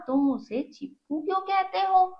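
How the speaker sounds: mild mock astonishment for the class; surprised question. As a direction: sound truly taken aback, pitch up. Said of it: "mummy ने हैरानी से पूछा".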